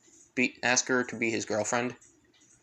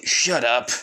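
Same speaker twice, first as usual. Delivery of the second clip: loud, teasing shut up; playful shut up; 2 semitones lower, 5 dB louder than usual. Acted. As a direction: brush off the teasing. Izuku snaps back at Naruto.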